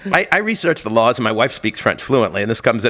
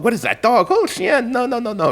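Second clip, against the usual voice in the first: high, flustered objection; mock a landlady refusing, voice pitched way up; flustered French landlady; 9 semitones higher than usual. French landlady turns away guests with a dog, flustered. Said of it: "the woman comes down".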